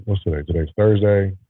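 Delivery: usual speaking voice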